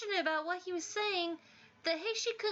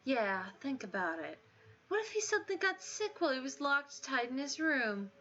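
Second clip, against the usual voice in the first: fake-earnest bully; 3 semitones lower than usual. Bully faking concern to the timid student, low and insincere. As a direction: sell it with false worry.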